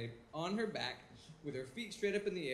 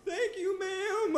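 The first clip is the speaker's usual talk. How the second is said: much higher, timid thank-you; high polite squeak; 12 semitones higher, 5 dB louder, slower than usual. Retold or imitated.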